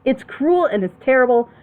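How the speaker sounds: normal talking voice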